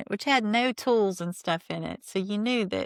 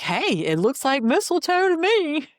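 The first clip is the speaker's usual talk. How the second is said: teasing husband; 10 semitones higher, 6 dB louder than usual. Retold or imitated. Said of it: "Cody's like".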